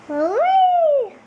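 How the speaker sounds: loud high hooray; cheering. Real, not acted. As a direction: shout it with joy. Child cheering.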